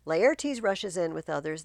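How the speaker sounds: normal talking voice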